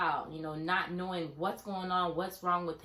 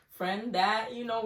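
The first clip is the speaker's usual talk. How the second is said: normal talking voice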